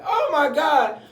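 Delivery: very high, loud exclamation; acted horror. Acting staff's growing shock.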